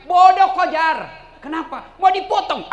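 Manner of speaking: loud, high scolding; scolding shout. Acted. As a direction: bark it at her in contempt. Setan membentak Hajar. (bentakan keras mengejek)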